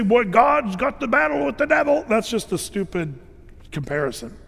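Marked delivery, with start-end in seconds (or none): 0.0-2.0 higher, light mocking voice; a hint of a mocking quote; mock naive believer. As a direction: parody a naive churchgoer. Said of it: "when we say".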